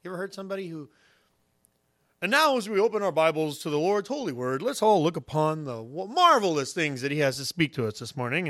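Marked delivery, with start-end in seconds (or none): 2.2-8.5 grand, mannered preacher voice; eloquent pastor. Acted. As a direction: intone it like a pastor showing off his vocabulary. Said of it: "pastors preach and they pray, and man, they have the best vocabulary".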